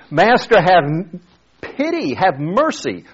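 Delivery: pleading, pitch breaking high on pity; acted desperate plea. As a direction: beg him like a broken man. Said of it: "And so it's".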